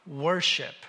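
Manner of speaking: ordinary talk, nothing marked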